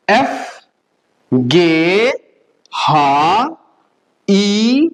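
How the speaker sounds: each letter from दे to फाउ drawn out, spaced apart; sustained letter names, दे through फाउ, with clear gaps; German alphabet recital. Shown as a demonstration. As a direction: model the German letters दे, ए, एफ slowly for repetition. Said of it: "मेरे साथ साथ दोबारा बोलिए".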